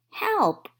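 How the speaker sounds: high, loud cry of help; yelp, dazzled by the sun; mock alarm. A narrator's reading. Toad dazzled by bright sun, high yelp. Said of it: "said Toad".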